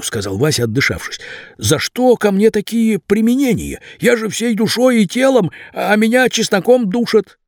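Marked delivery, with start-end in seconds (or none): 1.6-7.4 high, aggrieved pleading; pleading young man. A narrator's reading. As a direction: plead innocence in a higher voice. Vasya pleads with gang boss.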